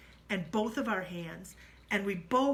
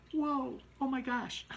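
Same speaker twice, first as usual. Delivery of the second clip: loud startled whoa, higher pitch; startled gasp; 6 semitones higher, 4 dB louder than usual. Retold or imitated. Narrator seeing the statue's hair move, startled exclamation. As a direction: blurt it out in shock.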